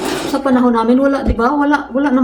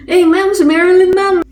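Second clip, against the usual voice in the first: tattling cousin; 8 semitones higher than usual. Retold or imitated. Cousin telling the teacher on Marilyn, high tattling. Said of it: "sabi nung pinsan ko".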